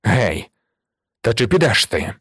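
understated gruff summons; calling out. A narrator's reading. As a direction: call out impatiently, with little extra volume. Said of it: "виступив на середину кімнати і гукнув".

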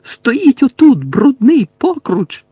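loud, high, slow-stretched screech; shrieking contempt. A narrator's reading. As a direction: spit the insult in a high yell. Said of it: "незв'язно верещала Белатриса".

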